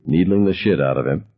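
usual speaking voice

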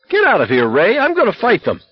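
high, frantic insistence; angry outburst. A narrator's reading. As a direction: drive your friend off, voice climbing high. McVrees shouting friend away.

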